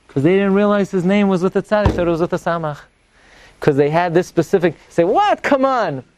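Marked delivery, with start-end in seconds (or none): 5.0-6.0 high, sharp "What?"; mock disbelief, come on; surprised exclamation. Impatient exclamation retold.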